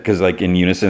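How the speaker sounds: plain reading voice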